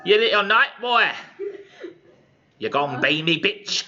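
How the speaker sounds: high, loud hammed-up Cockney screech; crass Cockney hag. Crass hag lords it over the young knight, hammed-up high Cockney. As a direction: crow it like a coarse Cockney crone. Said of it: "That's how she would talk".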